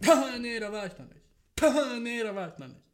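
his own voice looping from a phone; replayed phone clip. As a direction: play it back like a looping phone video. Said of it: "mach grad einen Filter drauf".

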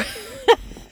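sudden squealing laugh; high burst of laughter. Real, not acted. Laughs after the mock yell.

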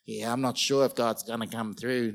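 low, doubtful mumble; doubtful naysayer. Retold or imitated. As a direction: sound unsure God will come through. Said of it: "That's the way they talk".

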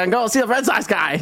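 imitating a thick Boston accent. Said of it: "a guy with a thick Boston accent".

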